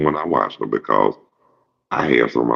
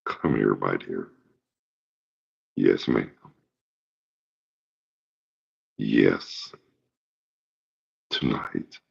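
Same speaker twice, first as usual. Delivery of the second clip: low and much softer, seductive; smooth Batman; 4 semitones lower, 8 dB quieter, slower than usual. Retold or imitated. Batman charming a lady, in a low, soft seductive murmur. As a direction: purr it like a ladies' man. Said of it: "He'd be like".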